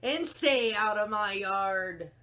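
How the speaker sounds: loud, slow indignant yell; yelled back. Acted. Hank yells back at the jackrabbit, loud and slow. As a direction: snap back at the teasing. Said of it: "Hank yelled back".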